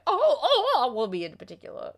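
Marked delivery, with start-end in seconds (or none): 0.1-0.9 high-pitched imitated "oh, oh"; retold group reaction. Retelling how all of us reacted, mock cries. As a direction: mimic startled riders, voice pitched way up. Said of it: "all of us were just like".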